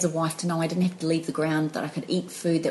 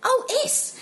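much higher, coy substitute words; softened replacement. Shown as a demonstration. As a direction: swap in a harmless word, sing-song high. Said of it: "doesn't have the same impact".

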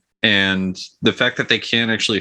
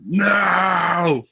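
high, drawn-out mock scream; Jack Ballard screaming; 8 semitones higher than usual. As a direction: imitate a long anguished wail from far away. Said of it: "Jack Ballard screaming somewhere".

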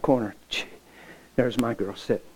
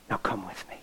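soft plea; 6 semitones lower, 9 dB quieter than usual. Retold or imitated. Urging her softly after lecture.

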